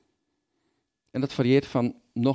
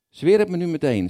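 loud, higher, insistent demand; demanding Jacob; 8 semitones higher, 8 dB louder than usual. Acted. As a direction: push hard for the oath right now.

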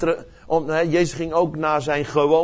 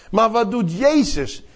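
ordinary delivery

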